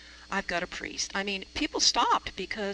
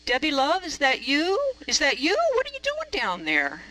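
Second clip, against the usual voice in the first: put-on a light Australian accent, called out loud and high; 7 semitones higher, 6 dB louder than usual. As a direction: call down into the ravine in an Australian accent. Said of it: "Australian accent".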